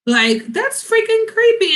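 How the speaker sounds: high, slowed disgust on freaking; drawn-out creeped-out exclamation. Real, not acted. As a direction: recoil at how creepy it was. Disgust at stalker.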